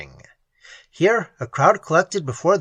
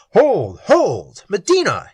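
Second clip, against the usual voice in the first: louder urgent cry to stop; exclaimed; 4 semitones higher, 6 dB louder, slower than usual. A narrator's reading. Cristoval stopping the sword fight: urgent loud exclaim. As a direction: call out to halt the duel. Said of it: "he exclaimed".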